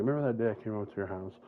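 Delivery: rattled off very fast; rushed retelling. Retold or imitated. Jalen re-asking about the day, rattled off fast. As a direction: blurt the line out in a hurry. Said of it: "it's a made-up memory, but he's like".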